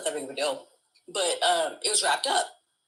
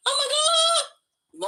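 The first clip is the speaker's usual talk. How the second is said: very high, drawn-out mock scream; theatrical wail; much slower than usual. Acting out while her family tries not to laugh, theatrical wail. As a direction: stretch it into a slow, showy wail. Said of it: "as soon as I started acting out".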